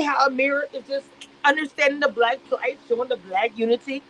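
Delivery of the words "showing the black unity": normal talking voice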